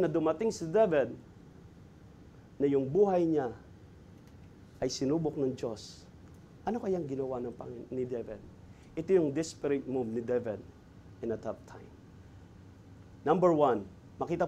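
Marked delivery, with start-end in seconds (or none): 2.6-11.9 hushed sermon. Real, not acted. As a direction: drop to a low, soft hush. Preacher lowers voice for trial.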